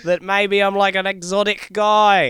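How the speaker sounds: Australian accent, exaggerated; mock Aussie backpacker. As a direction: play the lazy Aussie charmer. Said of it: "but I talk like this".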